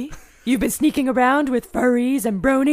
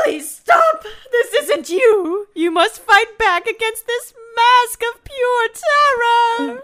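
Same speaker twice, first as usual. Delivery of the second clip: shouted plea; 11 semitones higher, 3 dB louder than usual. Acted. Dorothy shouts at possessed Willie to fight the mask. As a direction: shout high and loud, pleading.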